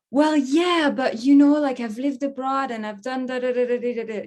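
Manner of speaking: protesting playfully, voice lifted; mock protest. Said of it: "and I'd be like".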